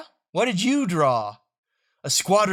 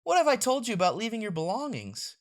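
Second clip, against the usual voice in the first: normal talking voice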